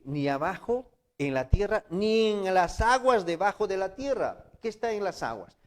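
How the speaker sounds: loud, high preaching; preaching at full force. Acted. Preacher thundering the commandment to the congregation.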